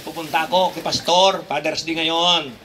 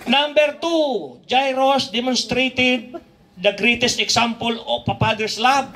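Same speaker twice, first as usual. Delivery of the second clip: slow, higher preaching voice; 8 semitones higher, much slower than usual. Acted. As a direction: lean into each word from the pulpit. Preaching the main point.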